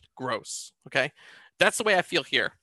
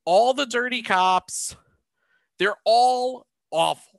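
disgusted; 7 semitones higher, 4 dB louder, slower than usual. Real, not acted. Emphatic disgust.

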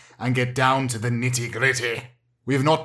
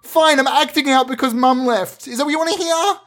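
put-on high, pained teenage outburst; teenage son finally admitting why he misbehaves, high and hurt; upset teenage son; 9 semitones higher than usual. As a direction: blurt it out like a hurt kid. Son's emotional outburst.

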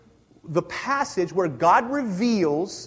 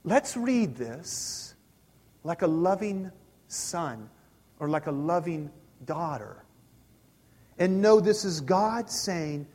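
ordinary talk

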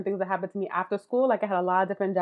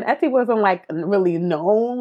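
ordinary talk, nothing marked

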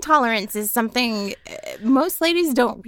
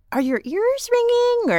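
much higher, slow, probing question; high concerned doctor; 12 semitones higher, slower than usual. Retold or imitated. Medic asking a migraine patient, high and slow. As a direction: ask it like a worried nurse. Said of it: "they'll go in".

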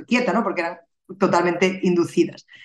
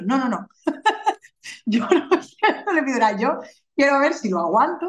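higher, laughing no-no-no, half amused at herself; giggly, stubborn and much higher-pitched; laughing refusal; 7 semitones higher than usual. Retold or imitated.

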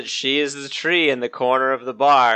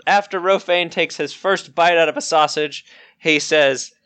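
ordinary talk, nothing marked

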